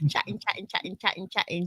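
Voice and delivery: softly repeated South London vowels; low-volume echo in a South London accent. Real, not acted. Host playing with the word after apologising, quiet South London accent. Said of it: "my South London accent is coming out now".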